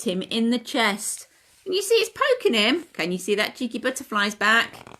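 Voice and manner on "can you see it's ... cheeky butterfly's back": usual speaking voice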